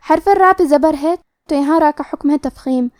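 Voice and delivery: usual speaking voice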